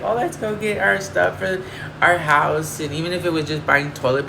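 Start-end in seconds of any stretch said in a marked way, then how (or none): 0.0-2.8 higher, slower, lightly voiced cheerful friend; a hint of a bubbly friend suggesting a shopping trip; pretend shopping buddy. Lonely streamer imagines a friend inviting her shopping, lightly voiced cheerful friend.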